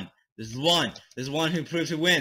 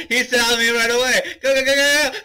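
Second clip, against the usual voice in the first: high, loud urgent yelling; frantic panic; 8 semitones higher, 4 dB louder than usual. Real, not acted. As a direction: shout as if being chased. Spotted by the monster, fleeing.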